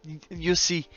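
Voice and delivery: a light mock Russian accent. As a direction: ham up a Russian man's accent. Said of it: "speak like Russian man".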